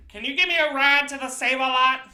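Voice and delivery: high, loud mock begging voice; pushy stranger. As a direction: holler it like a pestering panhandler.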